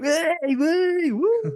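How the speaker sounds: very high, loud mock whooping; shrill hammed-up celebratory hollers; imitated gang cheering. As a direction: whoop like an overexcited gang, mockingly. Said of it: "the noises they make when they find that".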